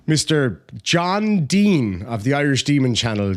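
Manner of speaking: ordinary talk, nothing marked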